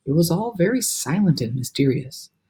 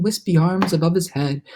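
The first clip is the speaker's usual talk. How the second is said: ordinary delivery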